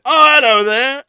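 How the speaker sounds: high, loud cartoon marmot greeting. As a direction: squeak out a hello as the marmot. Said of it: "It is the marmot".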